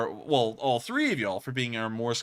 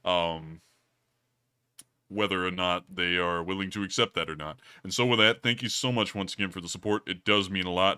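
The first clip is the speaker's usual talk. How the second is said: lower voice; 3 semitones lower than usual. Pretend. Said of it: "the low-tone voice that I've had".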